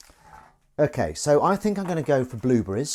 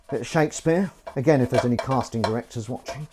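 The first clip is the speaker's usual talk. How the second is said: usual speaking voice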